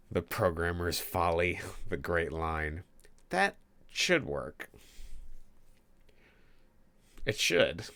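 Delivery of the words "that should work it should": "should" stretched high and loud; stress on should. Shown as a demonstration. Coder hamming up the programmer's folly, stress on "should". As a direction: lean hard on "should", slowly. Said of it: "Emphasis on should".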